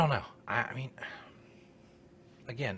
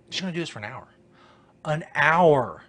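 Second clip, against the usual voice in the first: ordinary talk, nothing marked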